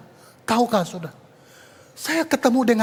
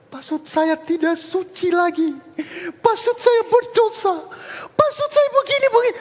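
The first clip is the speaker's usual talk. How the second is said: tearful confession; 9 semitones higher than usual. Retold or imitated. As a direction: plead it out like a crying student. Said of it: "dia utarakan semua dosa-dosanya". (siswa menangis mengaku dosa, suara tinggi pecah)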